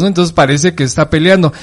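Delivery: normal talking voice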